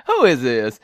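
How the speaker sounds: loud question out of a laugh; laugh, then demand who's calling; laughing disbelief. Retold or imitated. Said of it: "And I started laughing".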